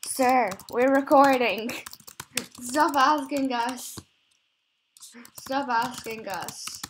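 slow, drawn-out loud plea; exasperated. Real, not acted. Player telling sir to stop asking while recording, exasperated. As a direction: scold them, stretching every word.

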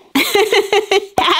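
high, rapid giggle. Acted. Girl tickled by her friend's mistake, giggling. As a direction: giggle in a quick high run.

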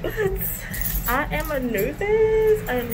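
speaking behind cloth mask; through a face mask. Real, not acted. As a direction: mouth covered by fabric. Seen 0: Cloth mask covers mouth and nose the whole time she speaks.

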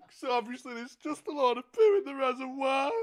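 working up into a mock breakdown; mock emotional. Prank caller winding up toward a hammed-up breakdown. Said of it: "caught up in my emotions".

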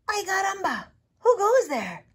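high, loud startled exclaim; startled cry. A narrator's reading. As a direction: blurt it out in alarm. Skippy John Jones exclaims.

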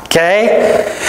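ordinary delivery

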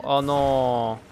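loud playful indignation; mock outrage. Real, not acted. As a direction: scold the teammate in jest. Laughing at teammate's fall.

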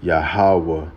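"Yahweh" said slowly as ya-ha-weh; Hebrew name. Shown as a demonstration. Preacher teaching viewers to say "Yahweh" as ya-ha-weh. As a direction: give "Yahweh" three open syllables, ya-ha-weh. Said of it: "that's how you say it in Hebrew".

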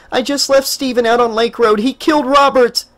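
high, urgent frantic voice. A narrator's reading. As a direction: Jessica bursts in on the sheriff for help.

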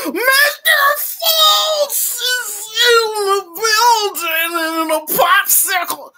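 hammed-up celebrity impression; Bill Cosby. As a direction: slide through the words like Bill Cosby. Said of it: "I can't believe I just did Bill Cosby".

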